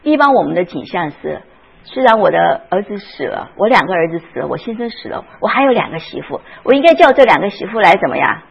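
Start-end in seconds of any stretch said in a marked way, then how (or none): none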